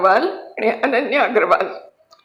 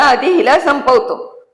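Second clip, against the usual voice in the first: raised, higher menacing threat; a drunk man's sudden raised threat over the cradle; 6 semitones higher, 3 dB louder than usual. A narrator's reading. Drunk husband threatening the baby, raised menacing voice.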